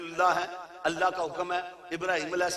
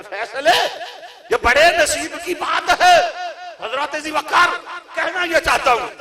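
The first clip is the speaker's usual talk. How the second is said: higher, louder outburst; climactic shout; 10 semitones higher, 8 dB louder than usual. Acted. Preacher crying out to the gathering at the climax, shouting. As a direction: shout it at the crowd.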